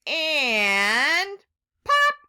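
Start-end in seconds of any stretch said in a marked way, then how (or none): 1.9-2.1 high loud pop; loud surprised pop; excited pop. A narrator's reading. Egg pops out.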